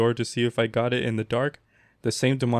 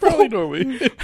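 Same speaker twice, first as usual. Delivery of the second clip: laughing echo; 13 semitones higher, 5 dB louder than usual. Real, not acted. Repeats laughing.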